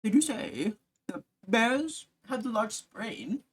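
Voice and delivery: slow, drawn-out mock gravity; mock serious. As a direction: say it like a solemn fact. Mock serious bear remark.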